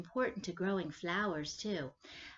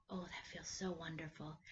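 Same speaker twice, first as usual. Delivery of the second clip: whisper; 4 semitones lower, 12 dB quieter than usual. Real, not acted. Relaxed after breathing exercise.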